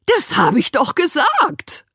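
high, loud, slow protest; indignant high social worker; Winnie insisting. A narrator's reading. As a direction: insist she said it. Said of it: "beteuerte die Frau".

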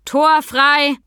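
loud, high call across a hall. A narrator's reading. Sam calls into the riding hall, calling out. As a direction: sing out a warning so the whole hall hears. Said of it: "Dann rief er in die Halle".

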